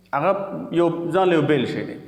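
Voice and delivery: ordinary talk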